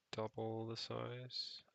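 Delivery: ordinary talk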